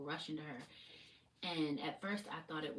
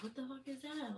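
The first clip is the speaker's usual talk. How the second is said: startled partner; 9 semitones higher than usual. Retold or imitated. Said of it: "my partner said he heard it too and was like".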